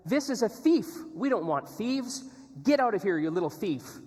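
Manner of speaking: high, loud imitated outburst from an affronted owner; indignant retort. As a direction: act out an owner shouting a thief away. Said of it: "The owner was taken aback and he said".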